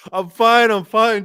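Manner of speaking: high, loud, hurried-off reassurance; mock fluster. Scalded man sending the woman away, mock fluster.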